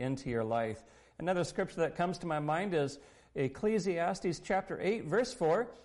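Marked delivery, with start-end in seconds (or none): none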